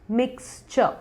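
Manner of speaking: मिक्सचर as "miks-chuh"; soft "chuh" ending on मिक्सचर, no r; correct pronunciation. Shown as a demonstration. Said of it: "correct word है". (मिक्सचर को "मिक्स-चअ" बोलें)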